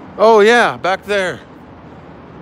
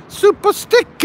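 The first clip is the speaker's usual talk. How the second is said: much higher, delighted squeal; excited squeal; 3 dB louder than usual. Real, not acted. Reacting to a stream sticker.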